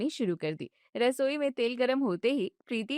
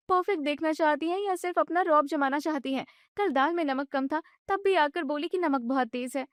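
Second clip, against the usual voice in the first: higher, fast, agitated wife; upset young wife; 5 semitones higher than usual. Acted. Wife vents to her husband about his mother, agitated and high. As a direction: vent it all at once.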